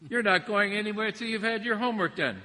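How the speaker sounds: hammed-up mom laying down the law; mock scolding mom. Preacher playing a mom forbidding her kid to go out, loud scold. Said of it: "such a mama thing to say".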